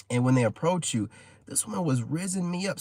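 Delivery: ordinary delivery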